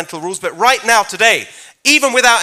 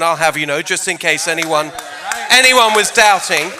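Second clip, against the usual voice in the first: ordinary delivery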